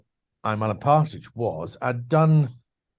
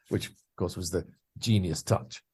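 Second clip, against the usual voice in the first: ordinary delivery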